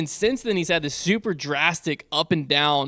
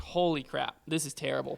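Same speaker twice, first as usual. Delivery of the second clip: much softer, a dismayed mutter under the breath; muttered aside; 10 dB quieter than usual. Retold or imitated. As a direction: mutter it in quiet horror. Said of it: "I was like".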